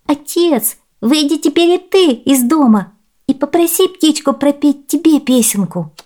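put-on high, coaxing child's voice; little girl. A narrator's reading. Girl asks father to go out to the bird, high eager voice. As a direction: urge him outside in a high girlish voice. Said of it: "сказала девочка".

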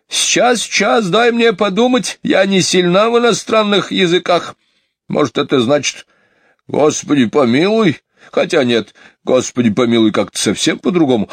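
high, fluttery thinking aloud; puzzling housekeeper. Acted. Housekeeper tries recalling foreign words, high and flustered.

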